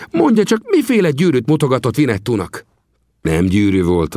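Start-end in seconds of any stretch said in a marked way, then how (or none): 0.1-2.7 much higher, nosy questioning; curious old trapper. Acted. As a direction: press him eagerly for the story.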